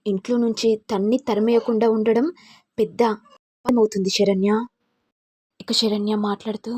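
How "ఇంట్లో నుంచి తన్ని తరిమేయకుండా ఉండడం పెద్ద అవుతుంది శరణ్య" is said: plain reading voice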